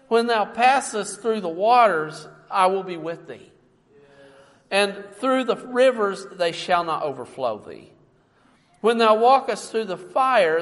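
loud, ringing scripture reading; full-force preacher reading; preaching. Acted. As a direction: proclaim it to the congregation.

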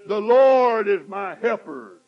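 loud slow proclamation; bold declaration. Acted. Preacher to congregation, bold loud declaration. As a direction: boldly proclaim it. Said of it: "repeated declaration".

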